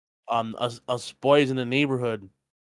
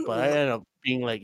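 ordinary talk, nothing marked

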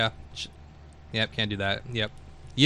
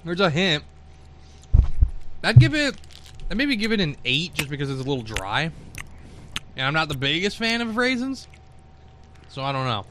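talking while chewing; mouth full; 3 dB quieter than usual. Real, not acted. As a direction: speak through a mouthful. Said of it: "I'm speaking with my mouth full".

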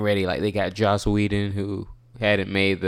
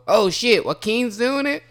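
surprised; 13 semitones higher than usual. Retold or imitated. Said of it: "they all go".

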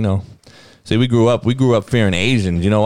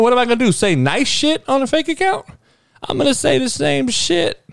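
much higher, heated and insistent; worked-up rant; 13 semitones higher than usual. Real, not acted. Denying burner accounts to his audience, heated and pitched way up. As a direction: voice climbs as he gets fired up.

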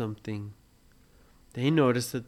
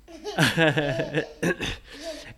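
light laugh; 3 semitones higher, 5 dB louder than usual. Real, not acted. Teller laughs after joke.

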